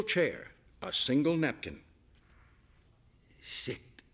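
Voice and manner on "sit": soft whispered sit; whispered command. A narrator's reading. Chef coaxing the guest into the chair, whispered. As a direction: breathe the word like a gentle order.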